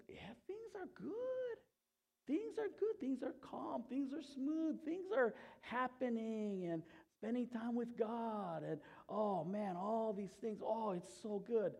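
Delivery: high, stretched-out mock bliss; faking contentment. Churchgoer answering how they are doing, faked contentment. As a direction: gush airily, as if all is perfectly fine. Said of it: "It's easy to fake it".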